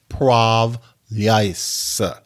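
"poprawiaj się" drawn out slowly, syllable by syllable; slow demonstration. Shown as a demonstration. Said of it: "So that's".

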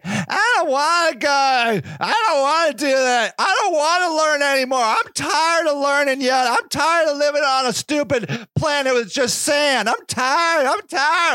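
mocking Luke's whiny voice to Vader. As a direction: whine like a sulky kid. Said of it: "just a whiny ass".

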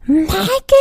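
mother shrieking into the phone; mom screaming. Retold or imitated. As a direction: scream the name in disbelief. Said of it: "screams and drops the phone".

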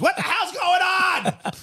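very high, slow shouted impression; Ken Campbell voice. Cohost redoing the line as Ken Campbell, shouting. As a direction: screech it out in a put-on voice. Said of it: "in my Ken Campbell voice".

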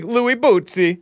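high, playful mock Italian accent; teasing mock-Italian lilt. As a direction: say the name in hammed-up Italian. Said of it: "say it in a mock Italian accent".

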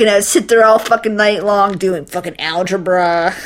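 ordinary talk, nothing marked

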